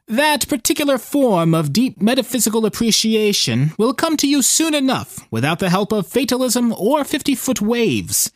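put-on a wry old sailor scolding a friend; old fisherman voice. Fisherman rebukes fatalism.

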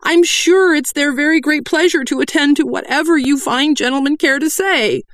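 very high, loud squeak. A narrator's reading. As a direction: squeak it in panic. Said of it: "voice at least two octaves higher".

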